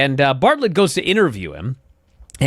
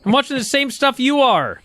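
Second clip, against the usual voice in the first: higher, mock-scolding a friend; mock exasperated retort; 9 semitones higher than usual. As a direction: talk down to him, amused. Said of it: "I said".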